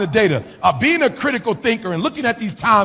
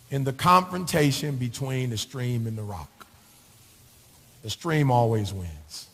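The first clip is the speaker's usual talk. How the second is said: softer and lower, slow and thoughtful; quiet, reflective; 10 semitones lower, 10 dB quieter than usual. Acted. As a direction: say it low, like sharing a secret truth. Quiet reflective take of the saying.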